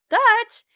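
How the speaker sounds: Dutch punched very high and loud; emphatic Dutch. A narrator's reading. Narrator singling out the Dutch exception, Dutch punched high and loud. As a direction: spike the word Dutch.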